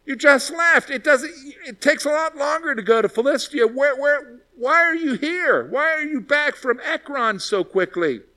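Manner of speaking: high, baffled king demanding answers; startled king. Retold or imitated. King to his returning messengers, high and baffled. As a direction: play the king, thrown that they're back so soon. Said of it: "And they're like".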